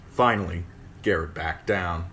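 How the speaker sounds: ordinary delivery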